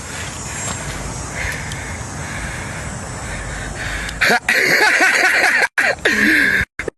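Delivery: breathless laugh at shoddy work; disbelieving airy laughter; long wheezing laugh. Real, not acted. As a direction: laugh out, almost silent and high.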